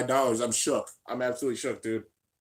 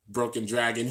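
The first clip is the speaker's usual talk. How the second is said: ordinary delivery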